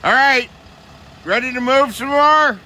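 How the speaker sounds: rally the team from a distance; calling out. Real, not acted. Calling crew to pull.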